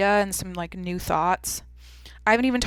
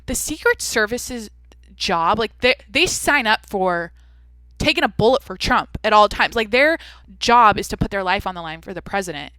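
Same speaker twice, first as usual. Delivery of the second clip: emphatic rant; 2 semitones higher, 8 dB louder than usual. Retold or imitated. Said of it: "And I'm like".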